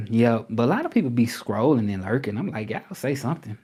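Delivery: ordinary talk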